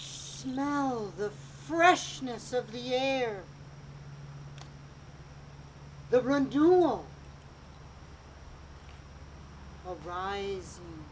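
slow, soft meditation voice; guided meditation. Acted. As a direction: ease them into calm.

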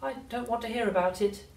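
put-on high, pleading boy; a boy begging her to stop; upset boy voice. A narrator's reading. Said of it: "cried George".

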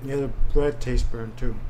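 ordinary delivery

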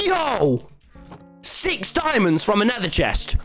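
high excited yell; hoarse sleep deprived hype. Real, not acted. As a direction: gasp then shout with delight. Finding diamonds.

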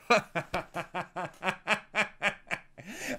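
long, rolling high laugh; sustained run of ha-ha laughter; amused laugh. Real, not acted. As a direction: crack up helplessly at the memory. The thing I laughed at.

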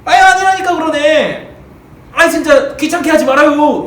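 annoyed denial. Acted. Peter irritably waving off the second servant, loud and fed up. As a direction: brush them off, fed up. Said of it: "그래서".

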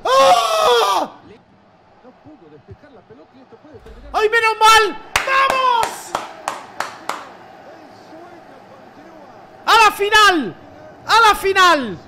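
very high, loud cheering; ecstatic celebration. Real, not acted. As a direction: scream with joy at the win. Celebrating reaching final.

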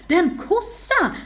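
high, loud, drawn-out insult; scandalised, slow and loud; indignant outburst. A narrator's reading. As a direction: spit it out in jealous outrage. Jealous heroine insults her cousin.

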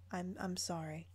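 trailing apology; sheepish. Real, not acted.

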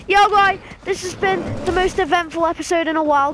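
frantic excited shouting; fast and loud, mid-fight; being chased. Real, not acted. Fighting the Yao Guai.

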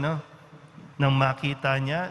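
ordinary talk

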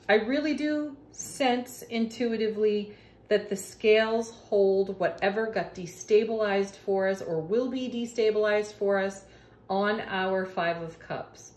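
usual speaking voice

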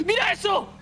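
high-pitched excited outburst; real gamer astonishment; amazed exclamation. Real, not acted. Spots something in game.

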